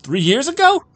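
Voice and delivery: high, loud puzzled bartender; a barman baffled by an angry customer. Acted.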